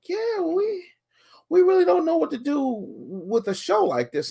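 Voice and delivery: talking down to him as the company man. Retold or imitated. Mocking the company man who wants to buy the podcast.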